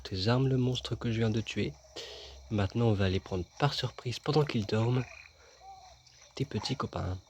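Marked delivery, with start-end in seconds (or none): none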